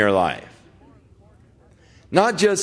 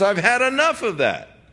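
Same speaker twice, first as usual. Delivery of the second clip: higher-pitched, quick retort of a wife walking out; lightly voiced exasperated wife; imitated fed-up wife; 9 semitones higher, faster than usual. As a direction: act out a wife who has had it. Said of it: "the wife says".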